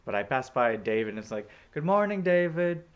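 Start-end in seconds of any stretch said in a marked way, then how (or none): 1.7-2.8 high, loud re-enacted morning hail; calling out greeting. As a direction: sing out a hello as you pass. Calling to David while passing.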